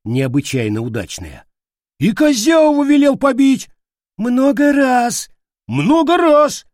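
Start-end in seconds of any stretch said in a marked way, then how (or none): 2.0-6.7 very high, loud, squealed accusation; squeaky complaint. Acted. Soldier piles complaints on the leader, squealing. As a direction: squeal the grievance insistently.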